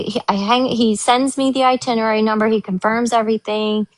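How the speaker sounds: ordinary talk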